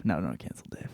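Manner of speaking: low, soft whisper; whispering aside to take it back. Real, not acted. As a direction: hush it like a secret aside. Quick aside backing off.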